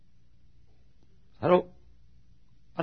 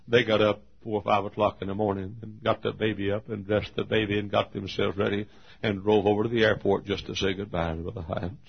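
ordinary talk, nothing marked

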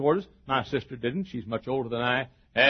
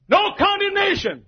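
high, loud preacher's proclamation; full-voiced pulpit cry; shout; 6 dB louder than usual. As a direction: shout it like a triumphant verdict. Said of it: "as I analyze this shout".

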